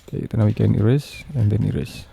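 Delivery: usual speaking voice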